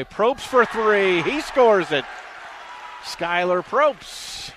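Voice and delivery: real excitement, dragging out the shooter's name; thrilled scoring call. Real, not acted. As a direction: roar the made three out to the radio crowd. Live call of a made three.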